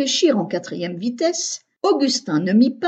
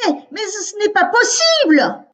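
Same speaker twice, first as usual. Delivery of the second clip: much higher and louder shocked yell; 11 semitones higher, 5 dB louder than usual. A narrator's reading. Mother Eugénie screams in disbelief inside the whale. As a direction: scream it so the whole belly shakes. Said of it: "hurla Eugénie si fort".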